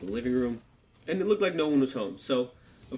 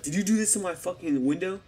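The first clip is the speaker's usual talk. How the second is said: imitating angry man; 6 semitones higher than usual. Angry man confronts the kids.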